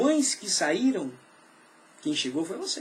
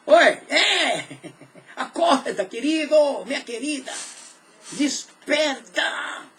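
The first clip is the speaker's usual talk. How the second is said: laughing wake-up; 5 semitones higher, 4 dB louder, much slower than usual. Real, not acted. Pastor playfully waking the congregation.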